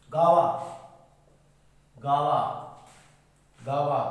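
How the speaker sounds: गावा said loudly as "gaa-va"; teacher drilling the word loudly; model word guava. Shown as a demonstration. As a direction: punch out गावा clearly. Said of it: "उसके बाद".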